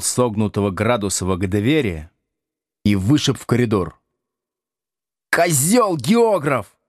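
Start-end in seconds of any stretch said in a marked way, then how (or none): 5.3-6.8 higher-pitched, drawn-out taunting yell; Градусов. A narrator's reading. Expelled pupil insults teacher from the corridor, yelling. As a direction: yell the insult back, stretching it out. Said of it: "заорал Градусов оттуда".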